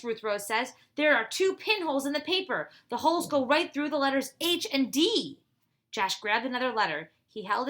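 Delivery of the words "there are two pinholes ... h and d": loud, higher, eager announcing; excited discovery. Acted. Ruth Rose to the boys about pinholes, excited. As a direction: share the find with rising excitement.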